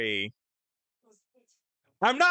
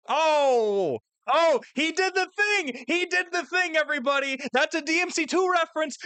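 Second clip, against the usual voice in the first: excited; 10 semitones higher, 3 dB louder than usual. Real, not acted. Spots a reference, gushing.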